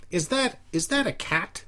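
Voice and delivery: high-pitched nasal parody; Al Bender. Retold or imitated. Friends talking about nothing in a gag voice, nasal impression. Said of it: "started talking like Al Bender".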